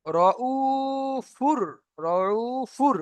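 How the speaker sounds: "রউফুর" with a long level u and an r ending; slow recited "রউফুর", no n at the end; ends in fur. Shown as a demonstration. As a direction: stretch the u, then close "রউফুর" on r. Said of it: "ফুর পড়ছি এখানে".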